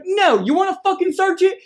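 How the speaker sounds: high-pitched, indignant retort from a retold argument; retold anger. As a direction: throw it back, mock-outraged, pitch jumping up. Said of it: "I don't know why you're getting so mad".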